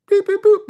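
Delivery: high beeping noise; imitated pager beeps. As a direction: beep like a pager.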